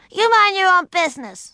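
put-on high, loud child telling off her sister; high-pitched loud rebuke; angry retort. A narrator's reading. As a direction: snap back at a nosy sister.

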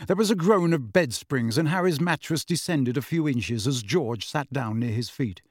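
usual speaking voice

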